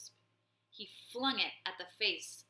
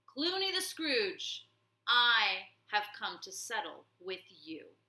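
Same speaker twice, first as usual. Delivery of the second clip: thunderous voice; 7 dB louder than usual. Acted. Warrior mouse challenging the warlord, thunderous call. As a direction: boom the name across the field. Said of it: "a thunderous voice".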